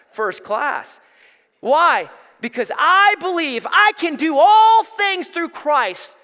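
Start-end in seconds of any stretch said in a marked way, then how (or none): 1.6-6.2 high, loud, slow shouted proclamation; triumphant preacher's shout of faith; preaching at full force. Acted. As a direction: cry out the verse in triumph. Sermon climax shout.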